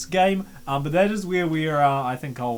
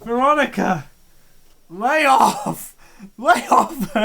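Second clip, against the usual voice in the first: loud, higher teasing shout at the screen; playful yell; 7 semitones higher, 6 dB louder, slower than usual. Real, not acted. Streamer shouting at Veronica on screen, playful yell. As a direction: call out to the character for fun. Streamer yelling at game character.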